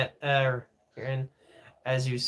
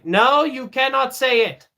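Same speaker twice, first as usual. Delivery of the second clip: raised, higher emphatic voice; firm refusal; 7 semitones higher, 5 dB louder than usual. Real, not acted. Streamer forbidding a viewer to say it, emphatic. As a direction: forbid it loudly, no room for argument.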